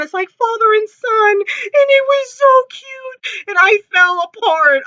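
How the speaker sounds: high, emotional fangirl squeal; overcome gushing. Real, not acted. Fan gushing over father and son bond, overcome. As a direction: let the pitch soar as you gush.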